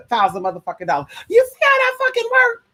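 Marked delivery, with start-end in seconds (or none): 1.3-2.6 squealed way up high, exasperated. Real, not acted. Ranting at her viewers, a high exasperated squeal. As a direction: shriek the question in disbelief.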